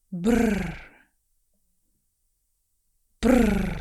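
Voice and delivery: Brr with a tongue-trilled r; rolled r. Shown as a demonstration. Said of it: "not with the lips, with the tongue and air".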